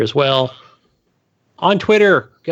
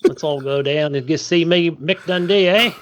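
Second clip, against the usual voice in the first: hammed-up mock Aussie accent; Australian accent; 3 semitones higher than usual. Co-host joining in on the Australian bit, mock Australian accent. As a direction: play at being an Australian bushman. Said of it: "The Australian accent".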